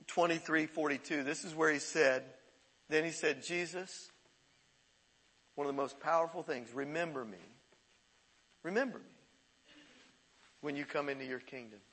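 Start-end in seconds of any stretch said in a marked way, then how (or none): 3.4-11.8 soft, low quoted plea; quiet plea. Retold or imitated. Preacher quoting the dying man to Jesus, quiet plea. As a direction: say it gently and quietly, with pauses.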